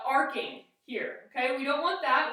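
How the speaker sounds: ordinary delivery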